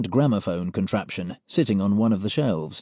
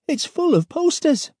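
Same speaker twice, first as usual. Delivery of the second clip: higher, put-on young man voice; Pete's voice. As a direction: voice Pete, amazed. Pete's voice in the story.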